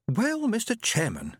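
put-on high, slow teasing girl voice; a young woman teasing her brother; sister's voice.

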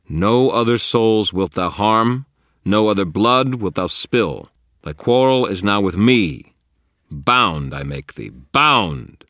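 slow, loud ritual command; incantation. A narrator's reading. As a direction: intone a binding spell with force. Wizard recites the binding spell.

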